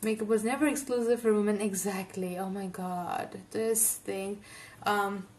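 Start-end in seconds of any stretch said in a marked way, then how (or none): none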